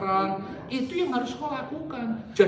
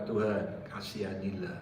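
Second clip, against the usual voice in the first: soft, low whispered plea; hushed prayer to God; 14 semitones lower, 11 dB quieter than usual. Retold or imitated. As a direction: pray under your breath. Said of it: "Saya hanya berdoa".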